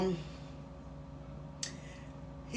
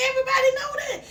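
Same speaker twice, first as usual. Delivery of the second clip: pitched way up, half-laughing disbelief; incredulous exclamation; 12 semitones higher, much faster than usual. Real, not acted. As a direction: throw it out like it's obvious to everyone. Mocking the ex-husband's excuses.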